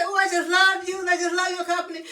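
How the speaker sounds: a hint of the sweet voice; gushing love voice. Quoting her greeting to viewers, lightly gushing. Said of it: "When I say".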